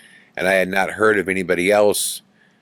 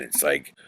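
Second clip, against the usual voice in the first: heavy breathing; 5 semitones lower, 4 dB quieter than usual. Shown as a demonstration. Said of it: "like this".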